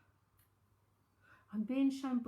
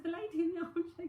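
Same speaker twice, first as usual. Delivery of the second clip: retold answer; 7 semitones higher, 7 dB louder than usual. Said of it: "I said".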